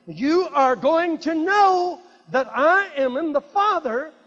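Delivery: loud, high, slow preacher's declaration; forceful scripture proclamation. Acted. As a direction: proclaim each phrase slowly at full force.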